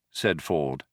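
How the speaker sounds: ordinary delivery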